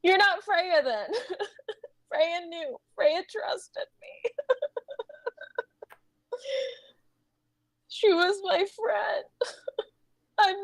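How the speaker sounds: grief breaking into breathy whimpers; crying as if struggling for air; choking sobs. Acted. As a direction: choke out each line between sobs. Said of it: "It sounds like you're choking".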